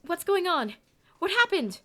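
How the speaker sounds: loud, high, breathless alarm; panicked shout. A narrator's reading.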